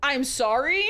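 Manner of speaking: ordinary talk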